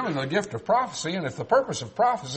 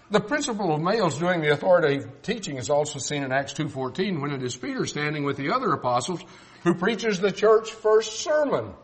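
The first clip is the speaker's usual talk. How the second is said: ordinary talk, nothing marked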